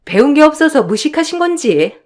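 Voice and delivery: mock her as if musing aloud; snide insult.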